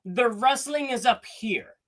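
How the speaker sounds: ordinary talk, nothing marked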